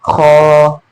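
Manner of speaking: खो said heavy, with the mouth filled; full-mouthed kha. Shown as a demonstration. Teacher showing students the heavy letter खो, full-voiced. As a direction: fill the mouth and let खो come out heavy. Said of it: "इस तरह पढ़ना".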